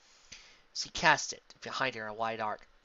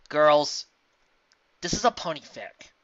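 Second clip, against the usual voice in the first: loud, high exasperated outburst; exasperated protest; 8 semitones higher, 16 dB louder than usual. Real, not acted. Narrator breaks story to protest.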